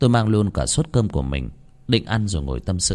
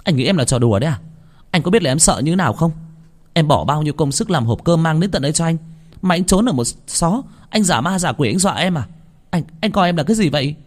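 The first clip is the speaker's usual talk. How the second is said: high, rising angry scolding, faster at the end; aggrieved outburst; 8 semitones higher than usual. A narrator's reading. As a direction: let the hurt boil over at him. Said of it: "một tràng ấm ức xả ra". (giọng trách móc uất ức, cao vút)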